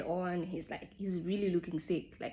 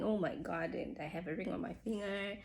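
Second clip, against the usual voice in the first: ordinary delivery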